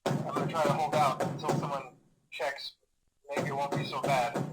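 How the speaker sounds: quiet, hesitant phone message, trailing into pauses; uneasy, halting. Acted. Night guard leaving a last message on a bad night, uneasy. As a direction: hold back fear and keep it casual, softly.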